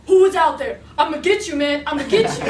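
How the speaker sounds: loud mock-tough threats, laughing through them; tough man voice. Scared girl faking a big scary guy to the intruder, loud mock-tough. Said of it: "I'm being a man".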